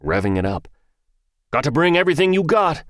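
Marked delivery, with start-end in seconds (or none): 1.5-2.8 loud, high pumped-up shout; too loud. A narrator's reading. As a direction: yell it like a hype man. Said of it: "way too loud".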